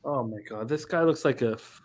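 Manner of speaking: ordinary delivery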